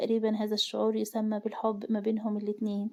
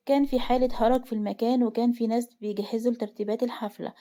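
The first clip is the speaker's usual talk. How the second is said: usual speaking voice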